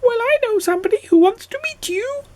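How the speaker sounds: quick, very high little goblin voice. A narrator's reading. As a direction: ask in a tiny creature's high pipe. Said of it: "said the goblin".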